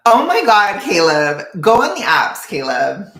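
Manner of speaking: high, loud exclamation; exasperated shout. Real, not acted. Streamer urging a viewer onto dating apps, exasperated shout.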